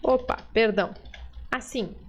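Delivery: normal talking voice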